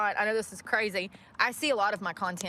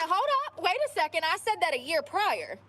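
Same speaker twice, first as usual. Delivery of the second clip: indignant surprise; 7 semitones higher than usual. Retold or imitated.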